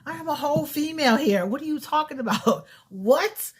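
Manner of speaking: incredulous, offended at being called sir; indignant disbelief. Retold or imitated. To the caller who said sir, high indignant outrage. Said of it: "I was like".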